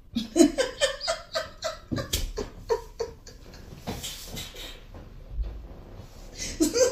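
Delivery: high airy burst of giggling; can't hold back a breathy giggle; breathless giggle. Real, not acted. Friend cracks up at the story, high breathy giggle.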